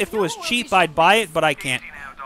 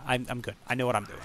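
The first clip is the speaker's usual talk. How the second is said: usual speaking voice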